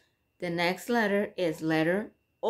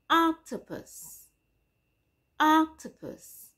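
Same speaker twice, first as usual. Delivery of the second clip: slow demo word; 9 semitones higher than usual. Shown as a demonstration. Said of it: "as in".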